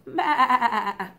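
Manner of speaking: loud bleating baa with a laugh; sheep bleat. Shown as a demonstration. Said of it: "the sheep all go like this".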